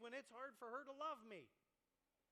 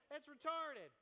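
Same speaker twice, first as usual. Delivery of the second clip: louder, higher blunt dismissal; scoffing punchline; 5 semitones higher, 7 dB louder, slower than usual. Real, not acted. Preacher scoffing at movie love, loud and blunt. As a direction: slam the idea down.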